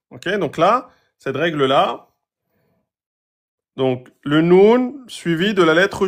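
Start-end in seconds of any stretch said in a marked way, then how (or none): none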